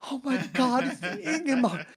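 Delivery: high, breathless starstruck squeal; a girl who can't believe her hero is there; starstruck gasp. Retold or imitated. Sister seeing Ingemar Stenmark, high starstruck squeal.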